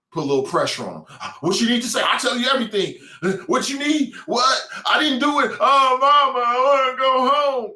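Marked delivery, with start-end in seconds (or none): 1.4-7.7 high, loud panicked pleading, a mock whimper at the end; mock scared snitch. Vlogger mocking a gang member caving to police, panicked high pleading. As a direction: play a tough guy caving in to the cops, begging. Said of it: "they'll fold in like a lawn chair".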